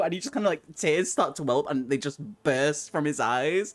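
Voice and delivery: usual speaking voice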